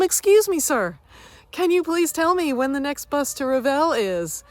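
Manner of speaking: high, bright-voiced polite tourist request; acted-out eager asking; polite inquiry. Acts out politely asking bus man.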